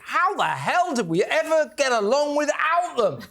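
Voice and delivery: mock amazement. Host mocking an advert's product, in mock amazement. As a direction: marvel at it in exaggerated wonder. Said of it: "you think".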